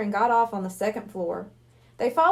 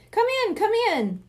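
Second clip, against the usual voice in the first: welcoming host; 9 semitones higher than usual. Acted. Hypnotist welcomes kids.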